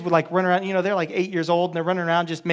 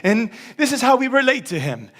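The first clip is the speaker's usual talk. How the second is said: lightly hammed-up peasant view, pitched up; mild caricature; 4 semitones higher than usual. Mocking how we picture lordship, mild caricature. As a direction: play a light caricature, dropping at the end. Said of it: "it's kind of this caricature".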